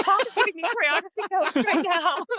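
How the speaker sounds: high, laughing mock disbelief; loud-ish giggly incredulity; amused disbelief. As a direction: retell it while cracking up, pitch shooting up. Quoting own amused reaction.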